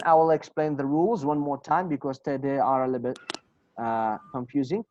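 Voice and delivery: usual speaking voice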